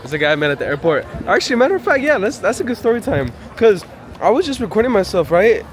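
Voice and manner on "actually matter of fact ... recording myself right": excited. Real, not acted. Excited start of story time.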